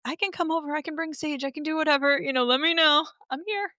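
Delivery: eager helper. Retold or imitated. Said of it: "but I'm like".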